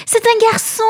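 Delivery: high, bright servant's exclamation; exclaiming. A narrator's reading. Servant girl discovering a baby boy, exclaiming high. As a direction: blurt out the discovery. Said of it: "s'exclama l'une d'elles".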